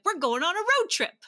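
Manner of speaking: loud, high imitation of an eager dad; excited announcement. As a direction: announce it like a big surprise. Voicing dad announcing the trip.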